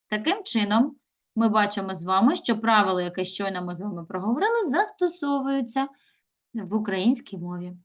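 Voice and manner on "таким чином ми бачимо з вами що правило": ordinary delivery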